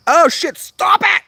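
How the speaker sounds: high, loud flattered shriek; excited squeal. Real, not acted. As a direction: squeal with delight at the praise. Reacts to comment.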